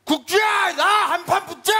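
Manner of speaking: high, loud yell; shouted challenge. Acted. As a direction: holler out a challenge to a fight. Acts out friend calling her out. (크게 소리쳐 시비 걸기)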